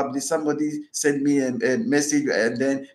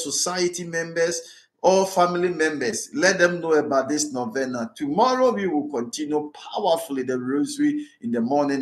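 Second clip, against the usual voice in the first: ordinary talk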